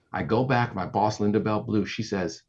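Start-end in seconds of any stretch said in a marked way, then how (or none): none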